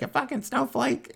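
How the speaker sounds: high-pitched mocking jab; retold taunt. Wounded girl to man who won't shoot, high taunt.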